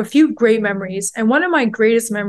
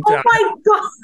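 excited gasp; 11 semitones higher than usual. Real, not acted. Excited gush over actor.

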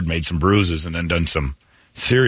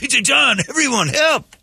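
mock call for help; slower than usual. Pretend call for neighborhood help.

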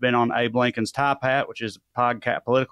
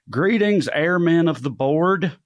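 grand letter reading; 6 semitones higher, 3 dB louder, slower than usual. Acted. Reading a listener letter aloud.

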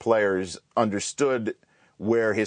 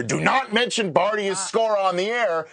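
retold scream; 9 semitones higher, slower than usual. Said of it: "screams in my ear".

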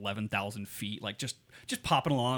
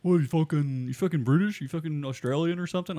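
loud, higher-pitched imitated challenge; mock indignant stranger; 5 semitones higher, 6 dB louder than usual. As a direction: confront him like an affronted local. Acting out an offended stranger's challenge.

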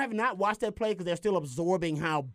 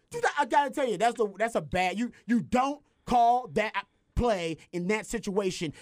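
ordinary delivery

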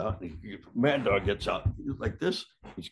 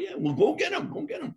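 exasperated urging. Retold or imitated. The speaker urging the police.

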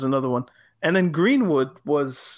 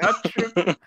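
laughing tease; 4 semitones higher than usual. Real, not acted. Teasing co-host, laughing.

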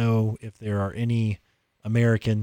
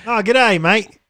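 high, loud mock Australian greeting; Australian accent; 10 semitones higher, 6 dB louder, slower than usual. Shown as a demonstration. Podcaster shows off the accent to co-host, mock Australian. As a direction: belt out a hammed-up Aussie accent. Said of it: "mixture between London and Australian".

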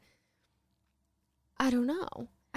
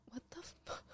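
voiceless, very hushed exasperation; retold whispered outburst; retold furious whisper; 6 semitones higher, 22 dB quieter, faster than usual. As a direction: whisper it under your breath in disbelief. Silent rage beside sleeping partner.

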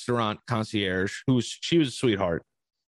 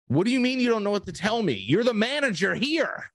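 incredulous, pitch jumping up high; exasperated retelling of a challenge; retold indignant protest; 9 semitones higher than usual. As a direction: argue it back in high-pitched disbelief. Retold argument with hotel manager.

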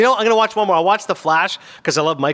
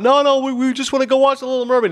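mock kids barking back; 10 semitones higher than usual. Kids arguing with the usher, high protest. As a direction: whine back at the man, pushing your case. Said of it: "some barking back about".

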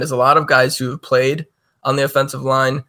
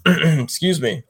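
clearing throat; 4 semitones higher, slower than usual. Real, not acted. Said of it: "Oh, frog in my throat".

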